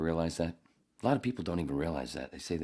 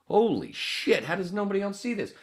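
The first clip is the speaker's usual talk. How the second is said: высоко и громко, наигранное открытие; изобрази внезапное прозрение; mock realization; 6 dB louder than usual. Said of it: "you're like".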